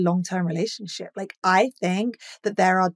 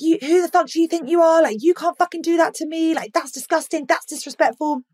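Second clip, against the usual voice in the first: high, loud, furious replay of the rant; reliving the blow-up, pitched way up and loud; retold furious yell; 10 semitones higher, 5 dB louder than usual. Yelling at her boyfriend in the walk-in closet, furious. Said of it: "I lost my fucking shit".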